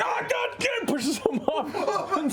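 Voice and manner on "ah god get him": high, strained shout; panicked guy. Acted. Stabbed guy pushing his attacker off, panicked high shout. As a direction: cry out in acted panic. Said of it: "And the guy's like".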